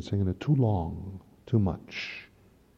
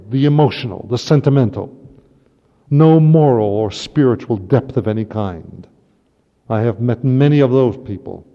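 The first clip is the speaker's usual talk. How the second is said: much louder, hammering each word; forceful preaching; 8 dB louder than usual. Real, not acted. Priest denouncing shallow souls to congregation, forceful. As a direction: preach it out to the pews.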